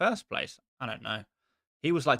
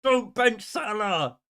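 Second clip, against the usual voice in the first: exasperated scolding; 8 semitones higher, 4 dB louder than usual. Retold or imitated. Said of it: "And I just wanna say".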